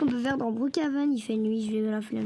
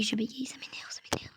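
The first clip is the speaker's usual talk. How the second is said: muttered aside; 3 semitones lower, 9 dB quieter than usual. Real, not acted.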